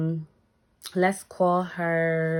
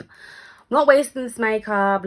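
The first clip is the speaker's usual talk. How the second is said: louder, slow and drawn out; defiant vow to herself, spoken slowly; 5 semitones higher, 7 dB louder than usual. Retold or imitated. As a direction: dig in, refusing to waste the effort. Retold self, defiant.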